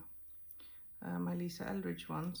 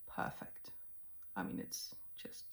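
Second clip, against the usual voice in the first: whispering; 6 dB quieter than usual. Real, not acted. Whispered aside over makeup.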